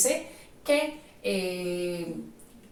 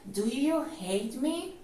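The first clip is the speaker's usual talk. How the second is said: "Do you hate me?" lento, subiendo al final; entonación de pregunta; 2 semitones higher, slower than usual. Shown as a demonstration. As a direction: pregunta despacio con la voz hacia arriba. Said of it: "Importantísimo que le pongas la entonación de pregunta". (entonación de pregunta marcada)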